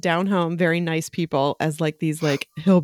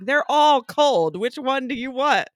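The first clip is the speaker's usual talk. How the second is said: exasperated; 7 semitones higher, 3 dB louder, slower than usual. Retold or imitated. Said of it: "And I was like".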